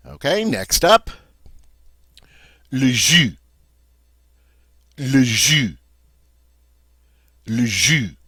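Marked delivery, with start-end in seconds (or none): 2.7-8.2 "jus" as zhu, with no final S sound; French pronunciation. Shown as a demonstration. Teacher shows the silent S in "Le jus". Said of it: "we don't say that final S".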